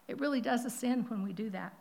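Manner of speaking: normal talking voice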